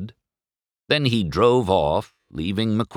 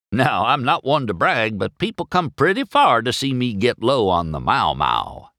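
higher, rambling, bragging tow truck; chatty Mater; 7 semitones higher than usual. A narrator's reading. Mater bragging to McQueen about whistling, chatty and high. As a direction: ramble on proudly in a raised voice.

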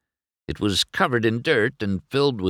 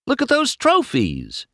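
very high, excited exclamation; thrilled, soaring voice; gushing; 4 dB louder than usual. A narrator's reading. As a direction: squeal it in starstruck admiration. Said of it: "McQueen gushed".

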